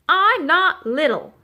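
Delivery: high, loud, drawn-out protest; indignant holler. A narrator's reading. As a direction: holler it back, offended. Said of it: "Thistle hollered".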